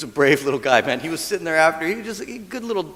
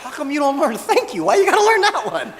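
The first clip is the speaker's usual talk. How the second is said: laughing exasperation; 11 semitones higher than usual. Real, not acted. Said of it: "laughing at kids".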